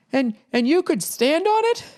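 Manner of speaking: put-on high, wide-eyed little kid asking; a puzzled child who has never seen ice; curious grandkid. Grandkid asking grandpa about frozen water, high curious child voice.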